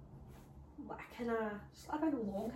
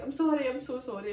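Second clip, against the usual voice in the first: 1 mock frantic apology; 5 semitones higher, 8 dB louder than usual. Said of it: "I was like".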